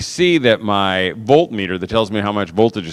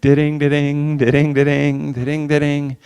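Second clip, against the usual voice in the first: imitating a guitar strum with voice; mock strumming; 3 semitones higher than usual. Son plays the song on guitar, mock strumming. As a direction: strum it out with da-dings. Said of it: "And he goes".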